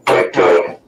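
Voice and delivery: deep, slow voice changer effect; disguised deep voice through a changer. Shown as a demonstration. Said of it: "we want to see it again".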